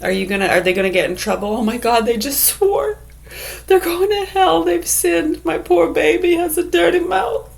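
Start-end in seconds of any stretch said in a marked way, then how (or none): 1.5-7.5 high-pitched mock horror; squealing pearl-clutcher, badly overwrought; scandalized parent. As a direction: wail in hammed-up moral panic. Mocks scandalized parent hearing a swear.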